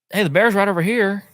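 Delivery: high, loud call to the group. Retold or imitated. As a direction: imitate a guide excitedly calling everyone over. Retelling guide calling out.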